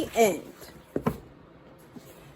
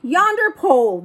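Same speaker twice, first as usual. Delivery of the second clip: loud pirate echo, higher; crew shouting; 8 semitones higher, 5 dB louder than usual. Acted. Pirate crew shouting farewell to the boy, loud. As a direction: shout it back as the whole crew. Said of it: "the others shouted".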